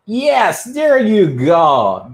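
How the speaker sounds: high, drawn-out cheer of praise; teacher delighted by a student's answer; excited praise. Real, not acted. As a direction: cheer the right answer, slow and big. Cheering a correct answer live.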